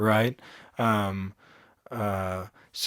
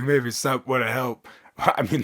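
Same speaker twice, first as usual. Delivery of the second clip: normal talking voice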